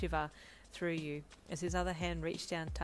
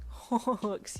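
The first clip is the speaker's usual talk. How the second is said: giggling; 5 semitones higher, 7 dB louder than usual. A narrator's reading. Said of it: "you giggled".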